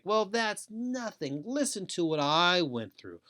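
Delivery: high, drawn-out dismissive brag; one-upping. Acted. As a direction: scoff and top their story. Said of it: "one-upping or trying to do".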